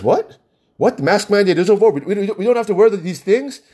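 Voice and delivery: high-pitched, rushed mock astonishment; imitated disbelief. Store worker learning the mandate is over, mock astonishment. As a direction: act out someone stunned by the news, pitch jumping up. Retold coworker news, disbelief.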